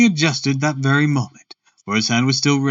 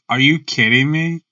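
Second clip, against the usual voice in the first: ordinary delivery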